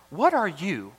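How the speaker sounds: "you" swooping high, then falling; stress on YOU. Shown as a demonstration. God challenging his prophet, stress on "you". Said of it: "a big emphasis on you".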